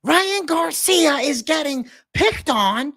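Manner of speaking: hammed-up high Doucette whine; Greg Doucette impression. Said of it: "Do a Greg Doucette on Ryan Garcia".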